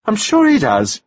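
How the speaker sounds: chattering widow, pleased with herself; gushing widow. A narrator's reading. Mrs Wells.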